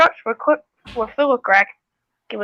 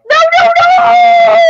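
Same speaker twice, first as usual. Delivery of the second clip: high frantic no no no; panicked shriek; 9 dB louder than usual. Acted. Toy engine shrieking as it is chased.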